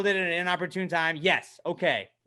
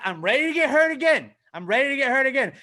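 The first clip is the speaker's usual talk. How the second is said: higher, louder eager declaration; hammed-up readiness for more pain; Michael Scott; 9 semitones higher, 6 dB louder than usual. Fan buying back in, quoting a sitcom boss, hammed-up. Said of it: "and it's Michael Scott".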